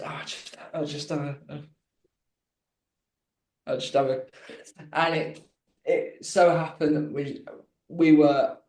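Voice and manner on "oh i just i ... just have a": hushed sneaky whisper. Retold or imitated. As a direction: whisper it so nobody hears. Sneaking off while she leaves room.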